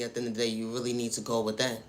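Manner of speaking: ordinary delivery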